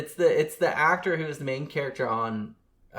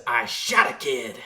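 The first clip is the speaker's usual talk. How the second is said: slow, loud movie cop confession; Reginald VelJohnson impression; 5 dB louder, slower than usual. Podcaster showing how the cop says it, slow loud impression. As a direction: mimic the Die Hard cop, drawn out and louder. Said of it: "It's the way he says it is really funny".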